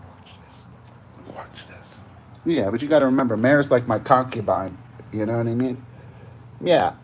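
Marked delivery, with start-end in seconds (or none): 0.1-1.9 whispering. Real, not acted. Hushed aside before nose trimming.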